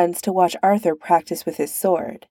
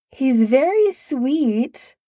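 slow, high call from another room; 7 semitones higher, much slower than usual. Acted. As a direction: call out lazily from afar. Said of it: "Morgana called out from one of the interior rooms".

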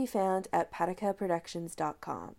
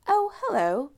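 put-on bright, raised British accent greeting, a touch louder; thick British accent; 8 semitones higher, 5 dB louder than usual. A narrator's reading. Woman greeting Allison in the cockpit, lightly voiced British accent. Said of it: "the woman said in a thick British accent".

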